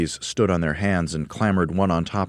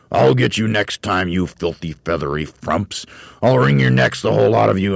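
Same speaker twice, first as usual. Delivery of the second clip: louder, higher furious threat; angry shout; 4 semitones higher, 6 dB louder than usual. Acted. Mister Twit yelling threats at the birds. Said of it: "Mister Twit screamed".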